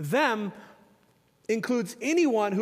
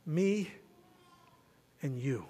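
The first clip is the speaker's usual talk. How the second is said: hushed; 7 semitones lower, 7 dB quieter, slower than usual. Real, not acted. Answering who is them.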